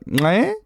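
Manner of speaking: "Ngai" with a tongue click in it. Shown as a demonstration. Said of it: "you gotta click your tongue".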